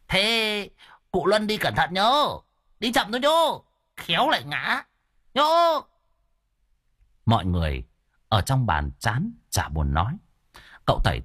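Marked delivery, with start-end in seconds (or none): 0.1-5.8 high-pitched teasing send-off; calling after someone. Acted. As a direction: call out to the old man leaving. Calling after departing deaf old man.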